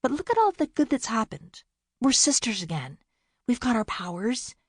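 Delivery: pouting. A narrator's reading. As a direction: whine it a little. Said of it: "Bea pouted".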